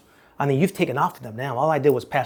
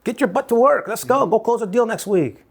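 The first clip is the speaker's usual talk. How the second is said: high, loud, pushy boss barking orders; 8 semitones higher, 4 dB louder than usual. Retold or imitated. Broker rushing a new agent to close deals. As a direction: hurry them out the door like an impatient broker.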